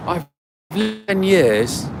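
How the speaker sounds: mock British customer's accent. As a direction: answer in a put-on British accent. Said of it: "with a British accent".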